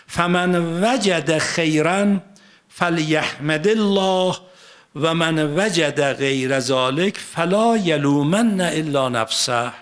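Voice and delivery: slow, drawn-out chanted Arabic; Quran recitation. Acted. Preacher reciting the verse to his congregation, slow solemn chant. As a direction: intone each phrase like a sacred verse.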